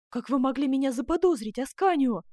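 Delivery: loud, high-pitched protest; exclaiming. A narrator's reading. Duchess to Ascanio who suspected her, loud exclamation. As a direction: cry out, hurt at being suspected. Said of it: "воскликнула герцогиня".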